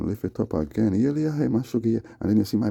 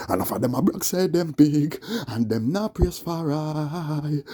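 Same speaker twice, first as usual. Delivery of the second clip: Rasta preacher voice; 6 semitones higher, slower than usual. Acted. Preaching Rasta chant, high.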